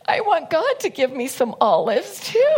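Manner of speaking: high-pitched tearful plea; hammed-up weepy wail; mock crying. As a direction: imitate someone blurting through tears. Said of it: "So she's crying".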